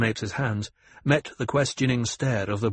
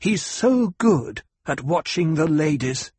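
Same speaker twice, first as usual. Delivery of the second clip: higher-pitched, bitter sarcasm; scornful mock praise; dripping bitterness; 6 semitones higher, 4 dB louder than usual. A narrator's reading. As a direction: let bitterness drip from the words. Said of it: "Her voice dripped bitterness".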